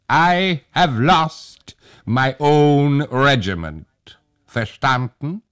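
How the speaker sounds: slow, loud, deliberate soldier; hussar, slow and loud. A narrator's reading. Hussar explains to old Tag slowly and loudly. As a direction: spell it out slowly to a foreigner.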